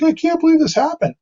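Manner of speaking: high, whiny mock sob voice; imitating crying client. Painter mimics the weeping client to his peer, high mock wail. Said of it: "she's like".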